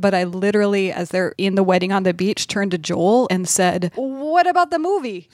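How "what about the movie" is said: high-pitched retold outburst; sudden alarmed realization. Host retelling turning to Joel mid-drama, suddenly alarmed. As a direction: blurt it out as if just remembering.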